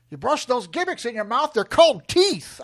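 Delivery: high, loud mock wrestler rant; Stone Cold impression. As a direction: punch it out, rising to a shouted last word. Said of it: "Stone Cold telling you how to".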